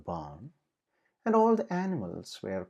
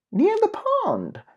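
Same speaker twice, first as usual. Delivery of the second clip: put-on very high, loud, slow child voice; eager to point the way; excited exclaim; 6 dB louder than usual. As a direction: piglet exclaiming near the pond. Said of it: "Patty exclaimed".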